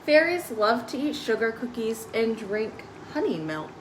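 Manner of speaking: ordinary delivery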